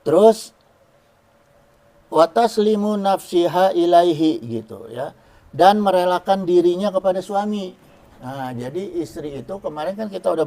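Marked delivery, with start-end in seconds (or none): 2.1-4.4 recitation. Shown as a demonstration. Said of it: "reciting Arabic text slowly".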